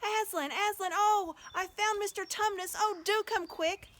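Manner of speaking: very high, loud thrilled call; Lucy shouting her joyful news. Acted. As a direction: call Aslan in delight. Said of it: "shouting out".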